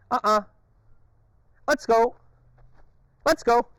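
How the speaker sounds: high, loud let's go; urging command. Real, not acted. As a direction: push her to move. Urging dog on.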